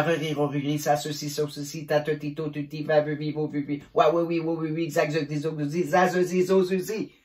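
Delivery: quick-fire monotone syllable chant; rapid one-breath drill. Shown as a demonstration. Teacher trying all consonants and vowels in one breath, rapid-fire chant. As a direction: race through every syllable without stopping for air. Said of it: "d'une seule respiration".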